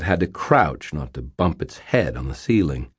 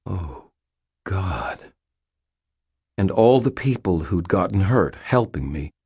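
ordinary delivery

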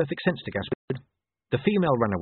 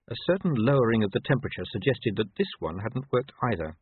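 ordinary delivery

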